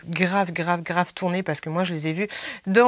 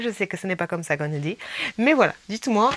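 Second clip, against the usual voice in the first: ordinary talk, nothing marked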